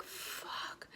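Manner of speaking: whispered swear; hushed retold dismay; deflated curse. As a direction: breathe it out almost soundlessly. Realizes she is locked in.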